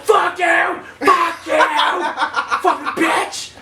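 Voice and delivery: high, furious bursts with pauses; retold fight screaming. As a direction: hurl insults mid-struggle, hammed up. Yelling during retold fight.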